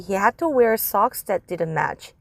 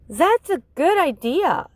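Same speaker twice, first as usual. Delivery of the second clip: higher approving teacher; 7 semitones higher than usual. Acted. Teacher approving Judy's idea, higher. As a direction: agree like an encouraging teacher.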